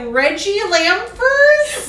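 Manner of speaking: slow, high, drawn-out Reggie Lamfers, unsure; mock teacher mispronouncing. Mother mocking teachers reading her son's name, slow and high. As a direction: imitate a teacher stumbling over the name. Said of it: "they're gonna be like".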